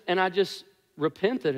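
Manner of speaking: ordinary talk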